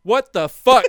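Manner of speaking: hammed-up annoyed outburst; mock outrage. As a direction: play the friend left out, offended. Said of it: "Chris is mad".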